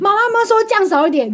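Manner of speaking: put-on high, childish whine about the sauce; mock whiny kid. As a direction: whine it like a cranky toddler. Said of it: "生出来说". (学小孩高音撒娇抱怨)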